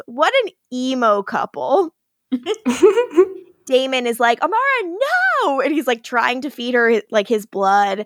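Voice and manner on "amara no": mock cry out. Damon cries out at stabbing.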